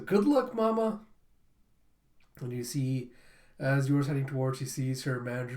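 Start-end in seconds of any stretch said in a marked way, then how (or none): 0.0-1.0 put-on high, louder childlike farewell; cheery send-off. Child seeing mother off to work, cheery send-off. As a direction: imitate a child calling good luck.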